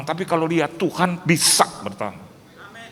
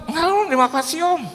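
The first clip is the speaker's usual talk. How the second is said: high put-on holy refusal; mock pious refusal; 9 semitones higher than usual. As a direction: decline politely, overly holy. Guest turns pious. (menolak dengan saleh dibuat-buat)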